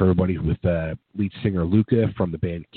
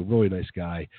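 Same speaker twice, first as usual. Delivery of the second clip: ordinary talk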